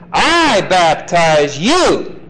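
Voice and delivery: I baptize you slowly, stress on I; emphatic contrast. Shown as a demonstration. Teacher contrasting John and Jesus, stressed I. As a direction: lean slow on I. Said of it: "Emphatic contrast".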